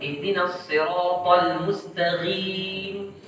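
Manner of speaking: slow "mustaqim" with gh in place of q; voiced throat gh in "mustaqim"; qaf turned ghain. Shown as a demonstration. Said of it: "mengubah huruf qaf menjadi ghain".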